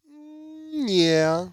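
drawn-out, doubtful agreement; hesitant yes. Retold or imitated. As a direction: imitate someone unsure, stalling before agreeing. Hesitant answer to question about miracles.

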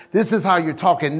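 normal talking voice

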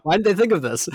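high, loud exasperated complaint. Real, not acted. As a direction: throw up your hands at it.

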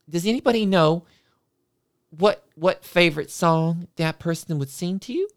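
high, sing-song music teacher cooing into a mic; sugary teacher voice. Retold or imitated. Music teacher cooing at a late class, high and sing-song. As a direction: gush like an overeager music teacher.

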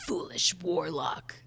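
slow, breathless whispered wheeze; wheezing demon. Acted. Host reads a demon's taunt in a wheezing whisper. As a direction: rasp it out like smoke come alive. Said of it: "try it again with a voice".

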